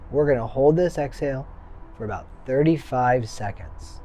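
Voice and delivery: calm, firm breathing coach; guided breathwork cues. Acted. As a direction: count them through each breath.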